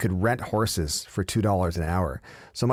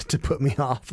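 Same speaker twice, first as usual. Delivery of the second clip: ordinary talk, nothing marked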